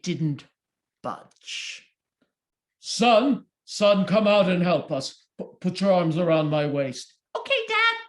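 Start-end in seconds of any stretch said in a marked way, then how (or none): 2.8-7.1 louder calling out; father calls son to pull. Acted. 7.3-8.0 put-on much higher young voice; child son. Son answers.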